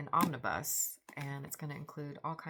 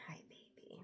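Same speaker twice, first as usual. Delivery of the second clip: whispered hello to the cat; 17 dB quieter than usual. Real, not acted. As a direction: breathe it to the kitty. Greeting the cat softly.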